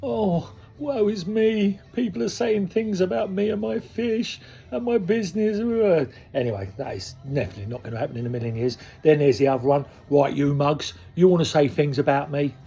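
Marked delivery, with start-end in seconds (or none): 0.0-5.6 high, whiny self-pity, slow on woe is me; a high, whining sorry-for-myself voice. Acted. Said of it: "I was gonna go at it, at the". 10.1-12.5 louder, squaring up like a hard man; a loud, tough-guy come-on; old Gary. Acted. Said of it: "That's old Gary".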